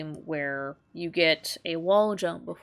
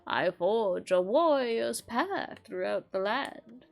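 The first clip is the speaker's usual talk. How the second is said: calm, slow wise master; a lightly voiced old sensei; 6 semitones higher than usual.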